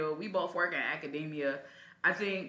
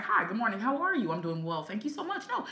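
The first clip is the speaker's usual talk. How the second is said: bright, higher polite office voice; professional small-talk voice; 5 semitones higher than usual. Retold or imitated. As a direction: put on the polished colleague voice. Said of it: "I sound like you".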